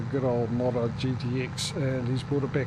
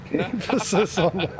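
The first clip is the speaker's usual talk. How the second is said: slow, higher words through laughter; laughing; 6 semitones higher, 3 dB louder, slower than usual. Real, not acted. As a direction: crack up while saying it. Laughing at being corrected.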